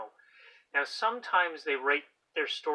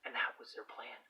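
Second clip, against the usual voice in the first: hushed secretive whisper; 3 semitones lower, 7 dB quieter than usual. Acted.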